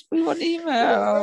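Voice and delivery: very high, drawn-out mock plea; mock wail. Mock pleading for listener emails.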